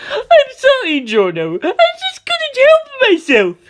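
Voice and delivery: high, shrill begging; terrified plea. Acted. Doppio on his knees begging Giorno in fear. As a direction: beg for mercy, trembling.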